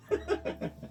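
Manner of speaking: a soft, breathless burst of laughter; breathy laugh. Real, not acted. Player laughing quietly at his pissed-himself hero, soft breathless laugh. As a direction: laugh under the breath at the absurd story.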